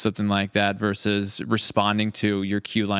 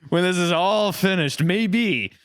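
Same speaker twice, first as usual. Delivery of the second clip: playful higher teasing lilt; 9 semitones higher, slower than usual. Real, not acted. Host teasing the cast about a reunion, playful. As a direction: tease them about a reunion.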